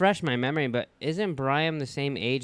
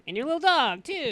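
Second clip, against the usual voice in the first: high cackling witch line, much higher; witch impression; 13 semitones higher than usual. Friend teasing the storyteller about the dog, witch voice. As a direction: tease like the Wicked Witch.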